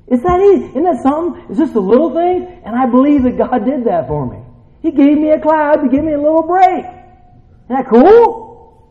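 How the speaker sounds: high, eager preacher's insistence; fired-up preaching. Real, not acted. Preacher crediting God for the cloud, excited. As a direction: push the wonder up to a big stretched question.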